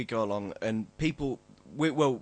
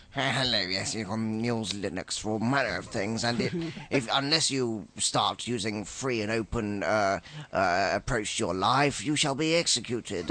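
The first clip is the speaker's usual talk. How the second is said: slow put-on voice of a Linux evangelist; hammed-up, drawn-out decree from a zealot; mock preachy zealot; slower than usual. Advocate ranting at fair visitors about free software, mock zealot voice. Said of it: "you don't go there and go".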